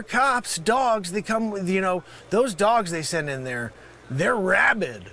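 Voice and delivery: usual speaking voice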